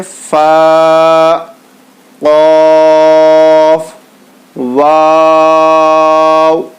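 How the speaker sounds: each letter name stretched long and slow, like a chant; drawn-out letter recitation. Shown as a demonstration. Teacher slowly chanting letter names to students. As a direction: sing out each letter slowly for the class to repeat.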